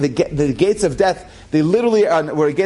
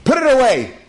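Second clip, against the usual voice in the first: loud, high sharp command; commander's order; 7 semitones higher, 4 dB louder than usual. Retold or imitated. Commanding officer stopping the soldier, sharp loud order. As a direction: stop him with a sharp order. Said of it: "the commanding officer grabs the revolver and says".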